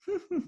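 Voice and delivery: short high giggle at his own prank; a quick high gleeful laugh; a burst of giggling over the joke. Real, not acted.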